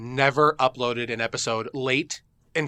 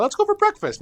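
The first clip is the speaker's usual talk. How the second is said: much higher, hammed-up cheer for breakfast; excited. Friend pitching breakfast, hammed-up high excited call. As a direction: squeal the invite like a kid. Mock-yelled repeat of own suggestion.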